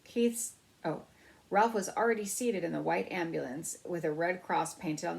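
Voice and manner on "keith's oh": ordinary delivery, nothing marked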